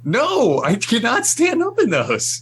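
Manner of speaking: exasperated protest. Real, not acted. Tall friend insists he can't stand in a sub, high exasperated protest.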